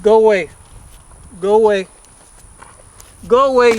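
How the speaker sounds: firm loud shoo at animals; calling out. Real, not acted. Rancher shooing cows from desk.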